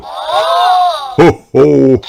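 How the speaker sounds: very high, loud hearty ho ho; booming merry ho ho; jolly cry. Acted. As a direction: crow it out with delight. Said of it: "he cries".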